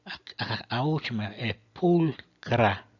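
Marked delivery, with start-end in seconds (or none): 1.7-2.9 "pulcra" with a hard K sound, slow and firm. Shown as a demonstration. Teacher showing Latin ch said as k. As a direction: hit the c in "pulcra" as a plain k. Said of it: "o C H soa como C".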